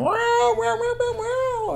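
very high, loud meowing; string of high-pitched yowls; crying cat. Acted. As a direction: wail like a trapped kitten. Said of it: "heard her going".

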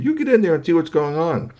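bossy mother. Acted. Mother ordering the father.

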